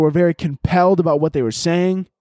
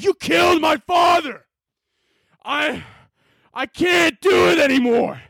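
overacted dub shout; 13 semitones higher, slower than usual. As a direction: scream it like an over-committed voice actor. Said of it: "I would have been like".

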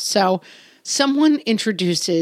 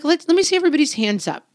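upbeat parent instruction; 7 semitones higher, faster than usual. Retold or imitated. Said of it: "I'm like".

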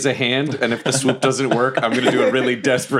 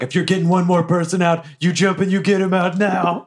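urgent order; 6 semitones higher than usual. Acted. Urgent order into magical phone.

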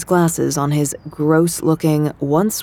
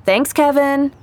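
raised, higher voice calling up to a friend; calling up the stairs; 9 semitones higher than usual. A narrator's reading. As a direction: call it up the stairwell, bright and higher. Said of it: "I called to him".

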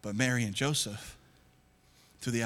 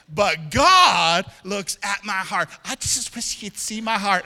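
mock pious husband; 5 semitones higher, 8 dB louder, slower than usual. Mocking wife-focused men's excuse.

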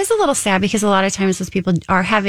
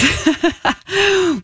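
a high burst of laughter; 12 semitones higher than usual. Real, not acted. Host laughing at seventeen years in one hour. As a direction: laugh at the joke about time.

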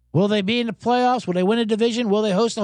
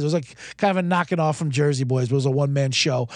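ordinary delivery

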